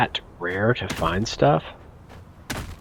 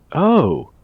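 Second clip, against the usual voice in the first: surprised; 8 semitones higher, 8 dB louder than usual. Real, not acted. Finds something useful.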